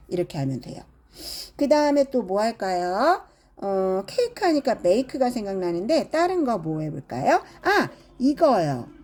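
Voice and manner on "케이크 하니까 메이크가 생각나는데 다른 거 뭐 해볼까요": usual speaking voice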